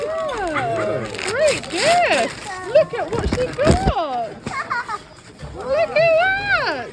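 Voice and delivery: high, slow, delighted cooing; excited. Real, not acted. Showing baby her gifts.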